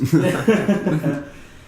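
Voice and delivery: big loud burst of laughter; real laugh, loud and high; hearty laugh. Real, not acted. Host laughs at nickname.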